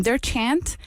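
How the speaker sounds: ordinary delivery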